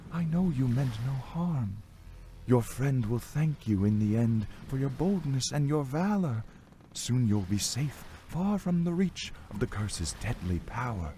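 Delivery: tender reassurance from a female ghost; soothing spirit. A narrator's reading. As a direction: soothe him in a light, high spirit voice. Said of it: "Her voice was soothing".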